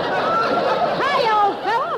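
laughing, pitched high; laughing greeting. Real, not acted. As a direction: say it through a laugh.

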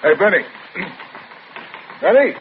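loud call across the room. Acted. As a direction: shout his name to get attention. Calling out across empty gym.

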